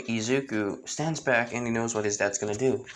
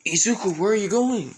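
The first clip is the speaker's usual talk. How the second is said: worried call; 9 semitones higher, 4 dB louder than usual. Acted. Father calls after wandering son.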